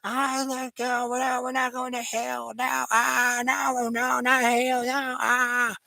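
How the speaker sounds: very high falsetto wailing; slow, drawn-out pleading in a squealed falsetto; mock panicked screaming. Storyteller mimics shooters dragged to hell, high hammed-up wail. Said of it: "they were screaming like little pansies".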